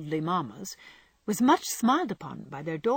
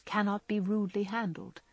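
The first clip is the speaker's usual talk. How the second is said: ordinary delivery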